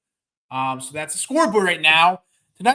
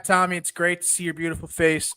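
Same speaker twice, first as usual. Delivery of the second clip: ordinary delivery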